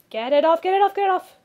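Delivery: frantic, swat at your back and yell; something landed on your back, panicked loud plea; panicked shouting. Acted.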